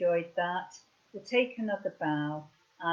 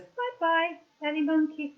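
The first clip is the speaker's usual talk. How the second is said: high, sing-song monkey bye-bye; high puppet voice saying goodbye; 7 semitones higher than usual. Pretend. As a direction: wave it off like a little toy monkey. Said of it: "Woolly Monkey goes".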